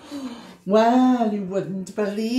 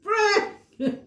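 alarm clock ring; 13 semitones higher than usual. Acted. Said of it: "went the alarm clock".

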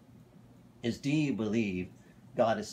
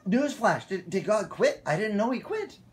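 high, sarcastic mock astonishment; put-on incredulous reply; mock surprise; 8 semitones higher, 3 dB louder than usual. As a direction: feign shock at breaking news, pitched high. Pastor feigning shock at complainers.

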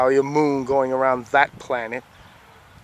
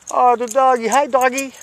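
high sing-song doggy voice, much higher and louder; 11 semitones higher, 5 dB louder than usual. Real, not acted. Calling to a neighbor's dog over the fence, high cooing baby talk. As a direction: coo at the dog like a pet owner.

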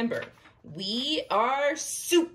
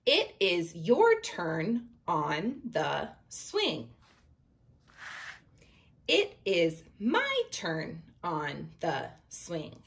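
slow, careful read-aloud; reading to children; much slower than usual. Acted. Teacher reading the picture book aloud to her class, slow. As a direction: read it slowly for young readers.

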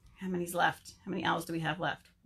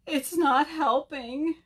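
high, slow whine; little bird wailing unhappily; crying complaint; 6 semitones higher, 4 dB louder, slower than usual. A narrator's reading. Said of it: "Bird cried some more".

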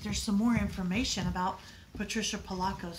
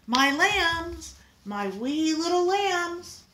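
much higher and louder, slowly calling out; relieved mother; 9 semitones higher, 6 dB louder, slower than usual. A narrator's reading. Mother finds her daughters and calls out, higher and louder. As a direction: call to the lost children in joyful relief.

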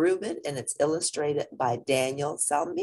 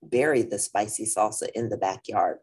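lively storybook reading; reading to children. A narrator's reading. Teacher reading a picture book to the class, lively. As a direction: read it out to the kids, bright and clear.

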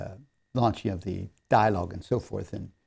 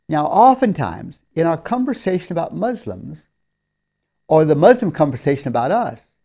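louder, higher preaching voice; 6 semitones higher, 8 dB louder than usual. Acted. Preacher urges the congregation to keep tongues from evil, preaching hard. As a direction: rise up and press the point home. Sermon exhortation at raised voice.

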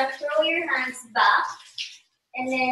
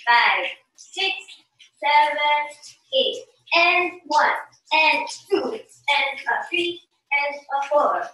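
brisker, rhythmic dance count; steady driving count with short gaps; count at tempo. Shown as a demonstration. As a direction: count the steps in at song tempo. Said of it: "Do the tempo".

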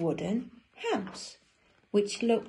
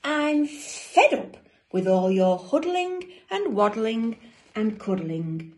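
higher, louder storybook grumble; fed-up penguin; 3 semitones higher, 6 dB louder than usual. Acted. Dudley fed up with all the huddling, loud complaint. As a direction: complain loudly, leaning on the words.

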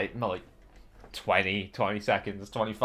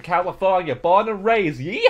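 put-on louder, rising cowboy-style American accent; hammed-up American accent; 6 semitones higher, 7 dB louder than usual. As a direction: build to a whooping American yeehaw. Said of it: "I am American".

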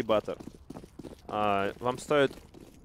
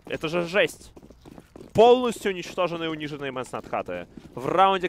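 louder, higher amazed casting; excited; 5 semitones higher, 5 dB louder than usual. Real, not acted. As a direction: hype up the result.

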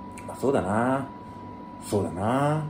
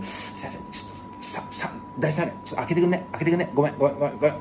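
hushed, softer, sheepish request; small voice; 7 dB quieter, much faster than usual. Shown as a demonstration. At the register, quietly asking to open lane three. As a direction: ask quietly, as if your stomach hurts. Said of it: "ちっちゃい声で".

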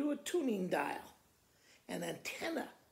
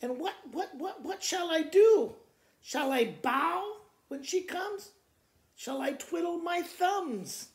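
high, loud, panicky farmer; a farmer in a flap over the queen; higher fretting voice, loud on what shall I do; flustered farmer; 8 semitones higher, 5 dB louder than usual. Acted. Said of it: "the farmer said to the owl".

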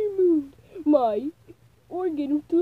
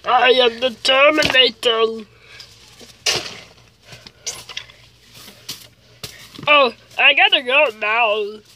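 loud deep robotic movie villain; deeper Terminator voice; 5 semitones lower, 5 dB louder than usual. Acted. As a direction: growl it like a cyborg. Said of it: "I got a deeper one".

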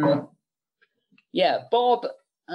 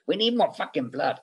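a light mock of a demanding producer; a quick playful Bob impression, barely changed from his own voice; Bob Weinstein impression. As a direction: toss off his gruff demand for gore. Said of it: "everyone will do this impression".

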